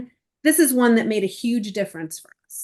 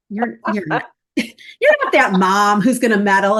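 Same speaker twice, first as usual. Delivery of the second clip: louder, laughing through the tease; teasing a meddling mom with a laugh; laughing warning; 3 semitones lower, 6 dB louder than usual. Acted. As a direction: laugh it out as a playful scolding. Coach voicing her warning to parents.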